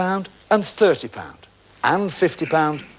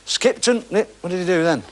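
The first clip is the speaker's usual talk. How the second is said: usual speaking voice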